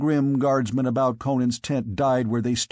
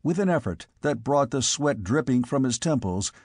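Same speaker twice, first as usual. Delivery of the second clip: ordinary delivery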